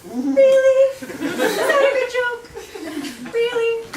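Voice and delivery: over-the-top incredulous. Retold or imitated. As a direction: squeal of mock disbelief at the source. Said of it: "where I was like".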